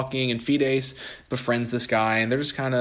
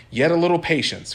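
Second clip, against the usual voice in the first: cried out; 6 semitones higher, 6 dB louder than usual. Acted. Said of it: "cried Experience".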